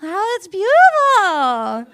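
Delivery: high, slow, drawn-out whoop; loud mock delight. Real, not acted. Comic reacting to crowd after joke, high loud gushing. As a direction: gush loudly over the reaction.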